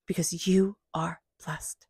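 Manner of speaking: slow whisper of reassurance; a whispered blessing to the listener; whispered affirmation. Shown as a demonstration. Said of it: "Believe it".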